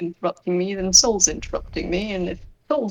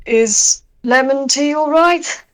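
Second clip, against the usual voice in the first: louder, higher, slow call over the shoulder; calling back; 6 semitones higher, 9 dB louder, slower than usual. Acted. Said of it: "rolls past and calls back".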